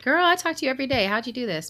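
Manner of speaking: fast, high, delighted disbelief; amazed to friend. Retold or imitated. Telling friend she kept the surprise, excited high gush. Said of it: "I'm like".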